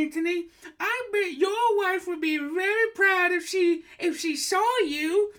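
very high, loud, gushing granny voice; a shrieking delighted old woman; high old lady voice. Acted. Host in falsetto as psychic, gushing shrill greeting. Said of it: "doing the voice".

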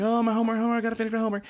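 high, fretting schoolgirl squeal; frantic anime girl. Retold or imitated. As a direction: wail over the homework in a high voice. Karen panicking over homework.

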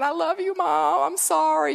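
hammed-up weepy kid voice; imitating crying child. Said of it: "come in there crying".

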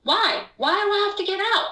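high, agitated why. Retold or imitated. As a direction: fire off the whys, alarmed and confused. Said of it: "I said to him in Spanish".

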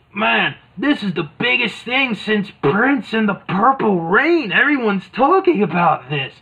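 hammed-up excitement; excited Mean Gene. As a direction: rave like it's the big event. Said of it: "Okerlund's like".

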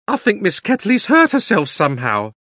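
high, loud, gossipy maid; excited housemaid. Acted. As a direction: deliver bad news with relish, high and loud. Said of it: "was really having a wonderful day".

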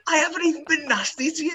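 high-pitched, wounded self-defence; retold squeal of indignation; indignant protest. Retelling an argument, protesting.

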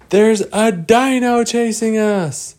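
high, slow, loud frightened cry; frightened warning. Acted. Lost Boys telling the kids a dino is chasing them, high scared. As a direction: spell out the danger in alarm.

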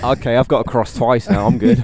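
laughing. Real, not acted. Laughing at own wrong answer.

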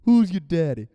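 loud imitation of a taunting kid; Austin. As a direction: crow it like a boy teasing his rival. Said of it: "he has, like, a lower voice".

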